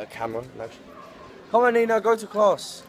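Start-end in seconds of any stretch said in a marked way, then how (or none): 1.5-2.8 raised, higher call to a friend. Real, not acted. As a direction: call her over, loud and high. Calling out to Nina.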